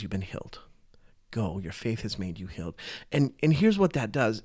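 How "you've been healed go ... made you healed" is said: soft, low whisper of blessing; Jesus to the healed woman, tender whisper. Retold or imitated. Jesus speaks gently to woman.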